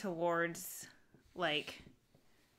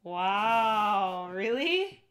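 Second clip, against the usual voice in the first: surprised; 2 semitones higher, 10 dB louder, much slower than usual. Real, not acted. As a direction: blurt it out, amazed. Reacting to the lemon bar.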